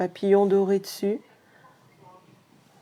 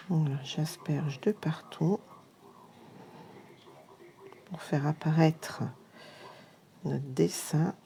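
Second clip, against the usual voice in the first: chuchotement concentré pendant le geste; chuchoté; 2 semitones lower, 4 dB quieter than usual. Real, not acted. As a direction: murmurer en travaillant. Saupoudre la poudre, concentrée.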